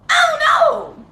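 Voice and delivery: a sudden, real yell of not knowing; frustrated shout. Real, not acted. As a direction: blurt it out high and loud in real exasperation. Venting rage about old attacker.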